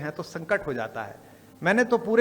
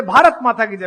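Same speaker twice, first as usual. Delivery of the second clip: shouted louder; 5 semitones higher, 10 dB louder than usual. Retold or imitated. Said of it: "जरा थोड़ा जोर से बोलिएगा".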